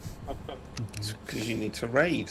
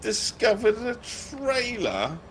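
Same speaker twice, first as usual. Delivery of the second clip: much higher, slow, drawn-out question; mocking game prompt; 12 semitones higher, much slower than usual. Shown as a demonstration. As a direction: stretch it out in mock wonder. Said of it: "Anyone else want to have a go at saying that with, uh, more derision?".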